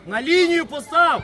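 loud, drawn-out command; shouted instruction. Real, not acted. Telling a player to place it on the line, shouting. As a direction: holler it slowly across the pitch.

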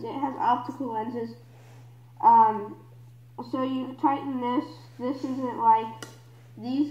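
muffled voice behind mask; talking through filter mask; speaking through a gas mask. Real, not acted. Seen 4: Gas mask covers the whole face in every frame while speaking.

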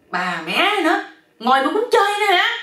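loud curse climbing very high; exasperated yell. A narrator's reading. Man bitten while sitting asleep, exasperated yell. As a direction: holler it in high-pitched outrage. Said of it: "ảnh la lên tiếp".

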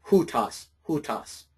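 "hutas" with the H sounded, repeated; rough breathing. Shown as a demonstration. Teacher repeating the correct form to students, "hutas" with an initial H. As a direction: start each "hutas" on an audible H. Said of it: "add that H in front of it".

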